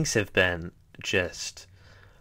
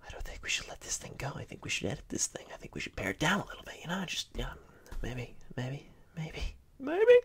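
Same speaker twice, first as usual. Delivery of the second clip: whispered second voice arguing back; sneaky whispered self-doubt; inner voice whisper; 7 semitones higher, 6 dB quieter than usual. Pretend.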